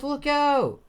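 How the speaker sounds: very high, loud shriek; panicked warning. Acted. Bonnie warns her friends of danger, panicked shriek. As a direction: scream a warning at the top of the voice. Acted warning cry repeated.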